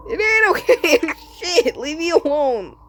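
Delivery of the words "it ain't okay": normal talking voice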